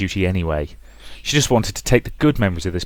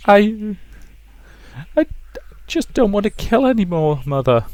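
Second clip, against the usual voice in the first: put-on high, timid girl's plea; a scared daughter daring to refuse; frightened girl; 13 semitones higher than usual. Rosa telling her mother she won't kill, timid high plea.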